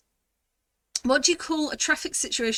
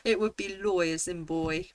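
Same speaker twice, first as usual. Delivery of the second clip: ordinary talk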